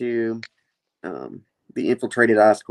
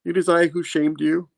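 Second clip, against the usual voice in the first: mild mock fear, pitched up; scared; 6 semitones higher than usual. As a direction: give in meekly, a touch frightened. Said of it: "you know, scared".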